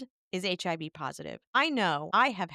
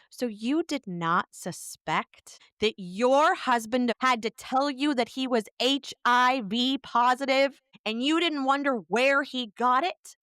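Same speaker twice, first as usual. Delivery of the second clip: higher, louder, rising disbelief; incredulous confrontation; 6 semitones higher, 4 dB louder than usual. Retold or imitated. Caller confronts the wife, loudly incredulous.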